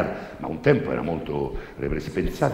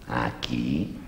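lower, hushed priest; 8 semitones lower, 3 dB quieter than usual. Acted. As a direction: ask like a sly confessor. Priest questions the girl.

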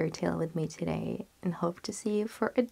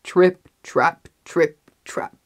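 loud, separate stomps on "Trip, trap"; heavy footsteps; 10 dB louder, slower than usual. A narrator's reading. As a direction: punch each "trip, trap" like a heavy tread. Heavy goat's steps on bridge.

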